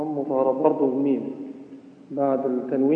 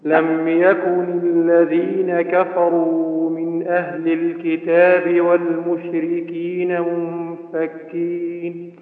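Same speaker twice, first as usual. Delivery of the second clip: Quran recitation; 2 semitones higher, 7 dB louder, much slower than usual. Acted. Student reciting to the teacher, steady melodic recitation.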